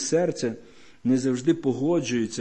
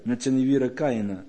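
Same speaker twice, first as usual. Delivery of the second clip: ordinary talk, nothing marked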